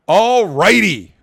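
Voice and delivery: host rallying listeners to the next segment, cheery and drawn out; cheery host exclamation. Real, not acted. Host moving the show along.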